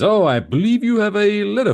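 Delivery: Homer's sly put-on voice. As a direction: sweetly fake his way through. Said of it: "He goes".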